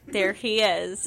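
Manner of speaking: ordinary delivery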